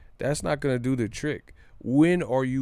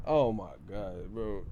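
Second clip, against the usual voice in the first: ordinary delivery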